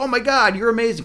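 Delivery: high-pitched excited gushing; pumped up. Acted. Queen seeing all the flax spun, pumped up. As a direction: gush with delighted amazement, voice up high. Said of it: "really pumped up".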